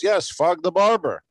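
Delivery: imitating the man insisting in his accent; Caribbean accent. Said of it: "He's got a big accent".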